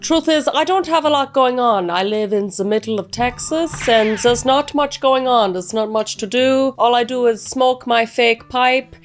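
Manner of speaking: hammed-up, very shitty German accent; bad German accent. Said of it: "speaking in a very shitty German accent".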